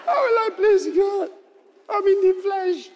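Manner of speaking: high, loud mock wail; mock crying. Preacher acting his own tearful plea about the flesh. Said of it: "I started crying".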